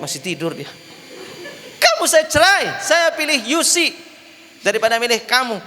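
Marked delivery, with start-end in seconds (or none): 1.8-5.6 high, dreamy mumbling in his sleep; sleep-talking husband. Retold or imitated. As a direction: talk in your sleep, half laughing. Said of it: "Sama kayak orang ngimpi".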